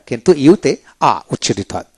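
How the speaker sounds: ordinary talk, nothing marked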